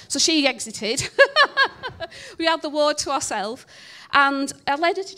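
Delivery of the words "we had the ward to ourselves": laughing. Real, not acted. Laughing at nurse leaving.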